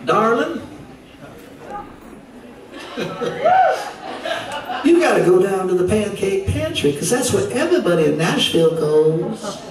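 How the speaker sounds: high, sweet Southern drawl, Nashville concierge. Retold or imitated. Concierge recommending the Pancake Pantry to a visitor, in a high Southern drawl. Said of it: "in that beautiful southern drawl".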